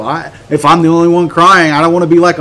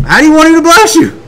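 high, incredulous pressing question; insistent follow-up; 10 semitones higher, 4 dB louder than usual. Acted. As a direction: press for specifics, high-pitched.

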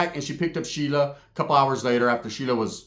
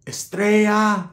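louder, higher, drawn-out call; imitated taunting call; 5 semitones higher, 3 dB louder, much slower than usual. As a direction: call it out as if from a passing car. Gang call from passing car.

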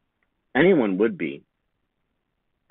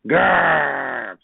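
lion roar. A narrator's reading. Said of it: "said the lion".

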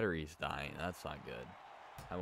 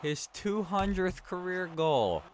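much higher, slow, delighted praise; excited; 12 semitones higher, slower than usual. Real, not acted. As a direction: cheer a milestone goal. Teammate scores milestone goal.

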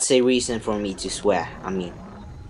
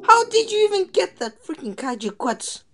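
taunting; 13 semitones higher than usual. Real, not acted. Taunting enemy dinosaur.

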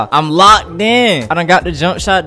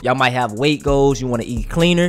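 ad read; 5 dB quieter than usual. Acted. Sponsor ad read mid-gameplay.

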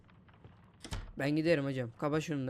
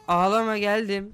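loud, higher-pitched call; calling to crying baby; 4 semitones higher, 6 dB louder than usual. Pretend. Babysitter rushing to a crying baby, calling out loudly. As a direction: call out loudly that you're coming.

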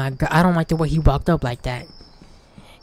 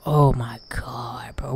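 slow unvoiced groan of words; breathy fed-up aside; exasperated whisper; 4 semitones lower, 4 dB quieter, slower than usual. Real, not acted. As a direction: whisper in disbelief. Frustrated at slow walker.

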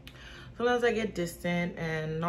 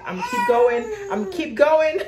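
loud, high pep talk to herself; forced upbeat self-encouragement; determined; 13 semitones higher, 11 dB louder, faster than usual. Real, not acted. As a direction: psych yourself up, loud. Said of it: "I'm not gonna be discouraged".

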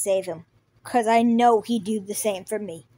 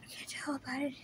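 ordinary talk, nothing marked

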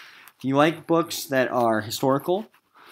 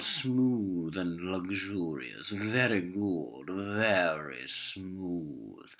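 slow savouring; 3 semitones lower, 4 dB quieter, much slower than usual. Acted. Captain savours his beard.

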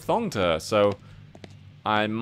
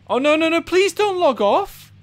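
panicked pleading; 5 dB louder than usual. Real, not acted. Player about to leave.

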